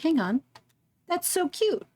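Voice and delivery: high, delighted coo. Real, not acted. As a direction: melt over something cute. Cooing at cute map sprite.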